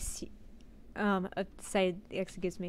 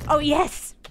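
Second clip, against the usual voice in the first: loud, higher joyful shout; relieved cheer; 7 semitones higher, 9 dB louder than usual. Real, not acted. Door finally opens, loud relieved cheer. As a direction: burst out in relief.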